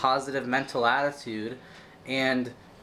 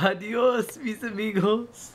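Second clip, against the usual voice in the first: higher, laughing Spanish goodbye; mock Spanish farewell; 9 semitones higher, slower than usual. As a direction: sign off grandly in Spanish.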